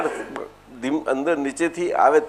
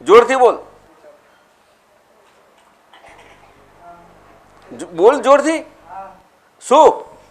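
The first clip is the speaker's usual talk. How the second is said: urging; 4 semitones higher, 4 dB louder than usual. Real, not acted. Guru urging child to answer.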